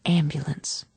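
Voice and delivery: barely voiced whisper; mother mouthing a warning over the patient; mouthed. A narrator's reading. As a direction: shape the word silently. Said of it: "she mouthed the word".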